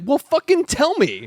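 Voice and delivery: high, drawn-out exasperated retort; mock mad. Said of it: "You can tell he's mad about it".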